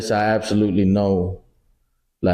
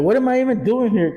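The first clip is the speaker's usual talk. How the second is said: exasperated wife; 11 semitones higher than usual. Retold or imitated. Wife's line in argument.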